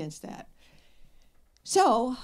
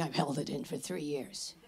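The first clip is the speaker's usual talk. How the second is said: bitter caller; 6 semitones lower than usual. Retold or imitated.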